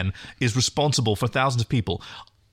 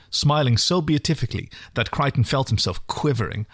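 ordinary talk

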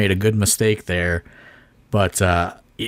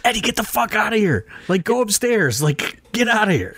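urgent shoo; 13 semitones higher than usual. Retold or imitated. Shooing a loud friend away.

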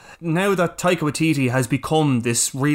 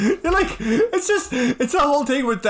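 laughing; 10 semitones higher than usual. Real, not acted. Laughing while recalling scene.

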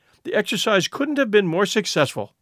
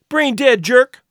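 higher, louder angry jab; angry insult; 7 semitones higher, 6 dB louder, slower than usual. Acted. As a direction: spit out the insult. Insult exchange between students.

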